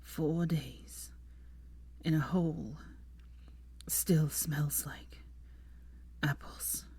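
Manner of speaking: very slow, halting, barely voiced; dying man drifting off; fading whisper. A narrator's reading. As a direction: let each phrase die away into breath. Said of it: "he really started to fade".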